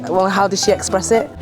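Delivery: normal talking voice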